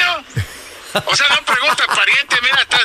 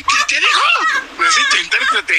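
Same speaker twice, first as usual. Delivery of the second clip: high, shouted mock confusion; teasing cry for an interpreter; mock bafflement; faster than usual. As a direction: act like you can't understand a word. Mocking Orejón's thick voice.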